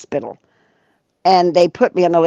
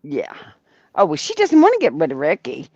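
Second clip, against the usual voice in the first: ordinary talk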